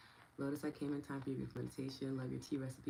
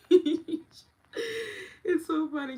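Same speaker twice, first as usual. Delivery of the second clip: laughing; 10 dB louder than usual. Real, not acted. Laughs while saying it.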